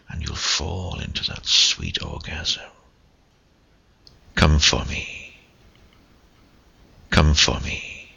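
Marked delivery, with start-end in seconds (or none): 4.3-8.1 commanding. Acted. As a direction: give the order, firm and close. Final climax command.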